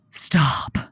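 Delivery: floored by the big news; shocked gasp. Real, not acted. [name] hears Kingsley will propose, stunned gasp.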